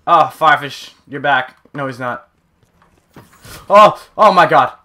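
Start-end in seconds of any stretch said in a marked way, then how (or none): 3.7-4.8 loud, higher startled exclamation; real scare, voice raised. Real, not acted. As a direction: jump with fright, drawing it out slowly. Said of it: "you scared me".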